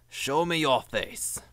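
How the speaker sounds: slow, theatrical challenge; dramatic demand. Pretend. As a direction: say it like a movie showdown.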